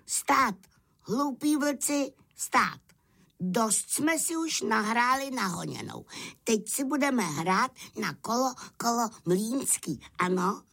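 very high lamb voice, bossy; crying out as lamb. A narrator's reading. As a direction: call out and take charge as a little lamb. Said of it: "a vykřikl". (beránek velitelsky pokřikuje vysokým hláskem)

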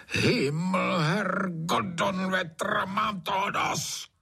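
high-pitched, drawn-out exasperated roar; angry roar. A narrator's reading. Sleepless landowner curses the singing frogs, angry roar. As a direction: bellow the curse, stretching the words. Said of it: "zařval Trautenberg".

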